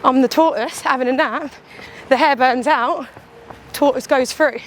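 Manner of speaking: ordinary delivery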